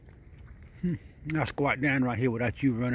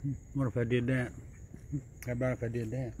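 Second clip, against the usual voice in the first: talking under breath; 7 dB quieter, faster than usual. Real, not acted. Talking low near animals.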